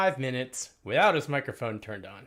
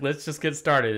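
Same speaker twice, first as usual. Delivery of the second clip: ordinary talk, nothing marked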